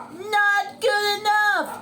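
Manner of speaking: very high, loud yell; angry coach shout. Acted. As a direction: bellow criticism at your athlete. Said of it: "shouted his coach".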